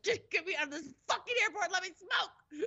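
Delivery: high-pitched retold tantrum; storming off, frantic. Smoker fleeing the airport, frantic and fed up. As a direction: plead and rage on the way out. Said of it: "I stormed off".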